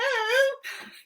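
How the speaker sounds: high puppet giggle; monkey giggle. Acted. Monkey puppet laughing at the children, high giggle.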